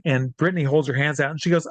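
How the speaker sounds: ordinary delivery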